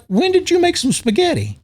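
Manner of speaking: high outraged mock question; incredulous man. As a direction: squeal it in disbelief. Retold Cops arrest argument.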